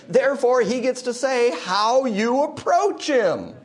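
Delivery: rising to a high, loud pulpit climax; full force preaching. Acted. Preacher to congregation, full force. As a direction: preach it hard, slowing on the peak.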